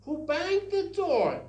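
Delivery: high, loud angry demand; bad-voice angry demo. Shown as a demonstration. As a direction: yell it like someone cross about a slammed door. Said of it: "with bad voice, say".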